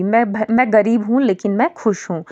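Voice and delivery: normal talking voice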